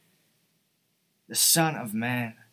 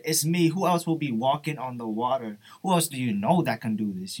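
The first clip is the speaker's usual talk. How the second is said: louder, exasperated retort; indignant imagined Jesus; 5 dB louder than usual. Retold or imitated. Preacher imagining himself as Jesus on the water, indignant and loud. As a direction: push it out loudly, as if it were obvious. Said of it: "if I was Jesus, I would say".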